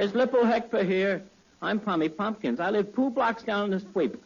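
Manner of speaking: "Lippo Hector" with every t turned to p; t sounds as p. Acted. Tommy greets Abbott with his t's all turned to p's. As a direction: swap each t for a puffed p, as in "Lippo". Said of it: "that's the way Tommy talks".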